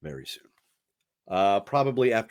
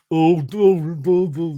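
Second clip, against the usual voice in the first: mock oafish boyfriend, louder and higher; dumb husband voice; 7 semitones higher, 8 dB louder, slower than usual. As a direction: imitate a dopey husband. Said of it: "do an imitation of their husbands or boyfriends".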